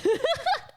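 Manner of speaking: squeaky burst of laughter; high-pitched giggle. Real, not acted. Laughs at own accent joke.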